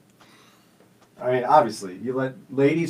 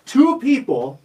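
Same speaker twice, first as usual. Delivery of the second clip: mock outrage; 12 semitones higher, 7 dB louder, much slower than usual. Real, not acted. Indignant outrage at mix-up.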